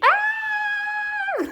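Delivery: high-pitched fan squeal; excited scream. Real, not acted. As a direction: shriek with delight at seeing her. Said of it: "I almost screamed".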